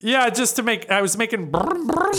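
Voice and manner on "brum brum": revving engine made with the lips; engine noise. Shown as a demonstration. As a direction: buzz the lips like a car engine. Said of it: "noises with my lips".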